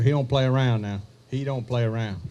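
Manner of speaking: ordinary talk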